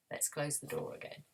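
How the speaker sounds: fast, hushed whisper; quick whisper. Pretend. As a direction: whisper it and hurry. Whispered, hurrying away.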